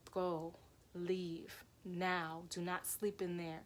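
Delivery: soft, very slow, spaced-out command; hushed, drawn-out urging; otherworldly warning. Retold or imitated. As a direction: quietly deliver a grave warning. Voice calling from the window.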